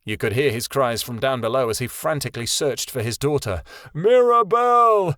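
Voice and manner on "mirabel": much higher and louder, slow long frantic bellow; desperate shout. A narrator's reading. Father frantically searching below, a desperate drawn-out shout. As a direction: stretch the name out in a frantic yell.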